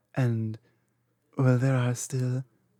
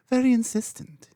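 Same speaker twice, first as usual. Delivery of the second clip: higher and louder, a sly playful poke; teasing emphasis; 9 semitones higher, 6 dB louder, faster than usual. Acted. Dragon teasing the trainee about the deal, playfully insistent. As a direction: lean on it teasingly, pitch up.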